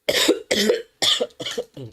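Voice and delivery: coughing from a dry throat. Real, not acted. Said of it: "my throat is really dry".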